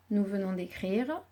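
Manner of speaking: ordinary talk, nothing marked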